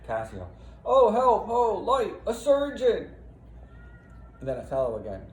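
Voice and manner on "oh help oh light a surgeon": high, loud cry for help; screaming in pain. Acted. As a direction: call out as a wounded man. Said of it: "Cassio screaming in pain".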